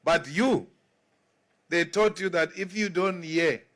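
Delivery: usual speaking voice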